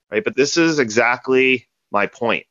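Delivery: ordinary delivery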